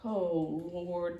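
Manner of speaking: ordinary delivery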